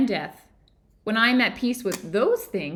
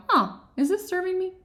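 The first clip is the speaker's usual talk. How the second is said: waking realization; 9 semitones higher than usual. Retold or imitated. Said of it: "before we wake up and go".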